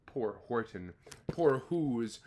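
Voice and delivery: ordinary delivery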